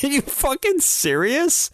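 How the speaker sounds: high, slow disbelief; incredulous. Real, not acted. Friend hears they tried to sell it, high and slow disbelief. Incredulous at selling used potty.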